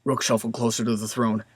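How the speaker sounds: usual speaking voice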